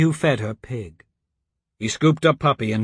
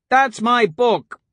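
put-on upset girl; 10 semitones higher, 4 dB louder, slower than usual. A narrator's reading. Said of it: "Elise said".

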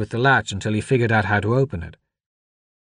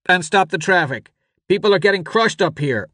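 high, raised voice over a crowd; urgent orders; 9 semitones higher than usual. A narrator's reading. Father orders the deputy amid a crush, urgent. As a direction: call out orders over screaming.

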